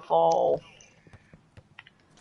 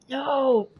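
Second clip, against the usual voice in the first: high anguished cry; dismayed cry; 10 semitones higher, much slower than usual. Real, not acted. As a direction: wail over the lost ball. Ball knocked away.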